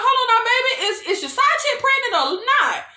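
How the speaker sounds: high-pitched, baffled questioning; confused interjection. Retold or imitated. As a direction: cut in, confused and demanding clarity. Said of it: "I get confused by the conversation".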